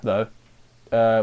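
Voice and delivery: ordinary talk, nothing marked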